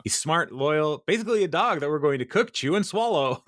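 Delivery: cracking up as the list speeds on; laughing while quoting. Retold or imitated. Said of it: "and he's like".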